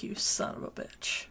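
unvoiced, breathy low curse; hissed whisper. Inigo cursing Rugen in a whisper at the end. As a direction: spit it out under the breath. Quoting Inigo's final line.

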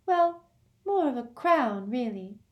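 put-on high, light girl's voice; airy and matter-of-fact; dreamy Luna. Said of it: "piped up Luna".

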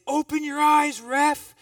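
high, loud angry yell; yelled at the ref. Retold or imitated. Player yelling at the referee: loud angry shout. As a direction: bark it at the ref across the court. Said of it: "I yelled".

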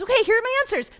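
high, loud, eager reply; enthusiastic God. Retold or imitated. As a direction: toss out answers like a keen coach. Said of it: "super enthusiastic".

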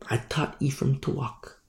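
soft whispered line for God; hushed whisper. Acted. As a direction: whisper it tenderly, like a secret. Quoting God's tender words.

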